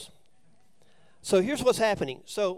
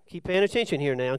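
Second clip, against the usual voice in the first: ordinary talk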